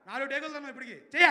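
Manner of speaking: mock scolding, rising to a yell; scolding director. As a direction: tell off the actor. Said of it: "తిడేస్తాయి". (నటుడిని తిట్టే director)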